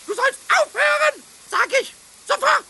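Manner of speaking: loud, high scolding order; angry command. Acted. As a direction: scold it like a stern boss. Scolding the milk pot.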